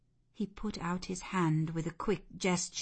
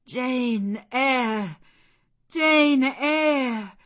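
loud, raised cry of wonder, building on the repeat; overwhelmed cry; 6 semitones higher, 9 dB louder, much slower than usual. A narrator's reading. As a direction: call the name out in disbelief. Man overwhelmed, repeats her name.

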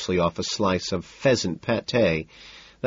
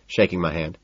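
ordinary delivery

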